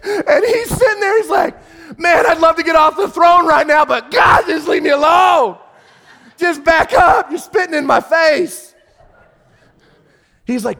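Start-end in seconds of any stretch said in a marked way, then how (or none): 2.0-8.7 high, loud, fed-up shouting; God fed up with a nagging believer; exasperated God. Acted. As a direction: yell to be left alone.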